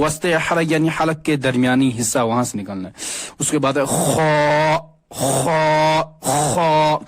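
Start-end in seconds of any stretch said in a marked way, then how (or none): 3.8-7.0 خا rasped from the throat, repeated slowly; drilled khaa. Shown as a demonstration. Teacher drilling students on خا, rasped from the throat. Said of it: "اس مشق کرنی پڑے گی".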